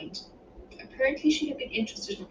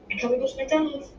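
put-on high, eager child's plea; raised, pleading child voice; imploring little girl; 6 semitones higher than usual. A narrator's reading. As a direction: beg like a hopeful kid. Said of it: "Hannah implored".

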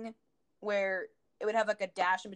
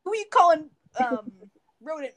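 loud offended squeal; indignant yell; 5 semitones higher, 9 dB louder than usual. Retold or imitated. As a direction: shout it, insulted. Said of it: "he goes".

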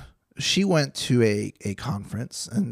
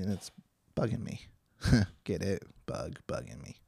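low, soft throwaway pun; quiet, amused aside to yourself; muttered aside; 3 semitones lower, 9 dB quieter than usual. Real, not acted. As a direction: toss it off under your breath. Muttered pun aside about a bug.

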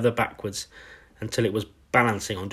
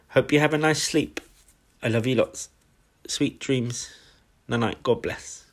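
tender goodnight; 4 semitones higher than usual. Real, not acted. Reader sending the listener off to sleep, tenderly. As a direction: wish a loved one goodnight gently.

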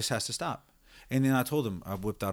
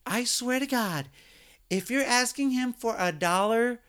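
high, slow mock yell from across the warehouse; mock screaming supervisor; 12 semitones higher, slower than usual. Supervisor yelling across the warehouse, high mock shout. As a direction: holler it up high like a boss across the floor. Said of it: "she screams from half the warehouse".

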